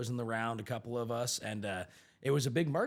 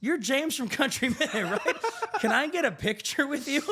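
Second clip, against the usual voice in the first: starstruck fan; 12 semitones higher, 5 dB louder than usual. Retold or imitated. Fan asks James for a picture, high and eager with a laugh.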